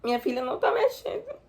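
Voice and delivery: high, tearful sob-like voice; crying. Real, not acted. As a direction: break down on the words. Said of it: "Desculpa, gente". (voz chorosa e aguda)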